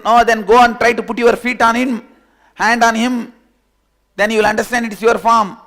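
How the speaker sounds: high, loud urging; loud insisting. Acted. Fellow loudly dares the other to touch the water. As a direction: push him to go and check, loudly.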